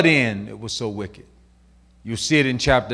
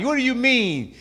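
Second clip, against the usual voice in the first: normal talking voice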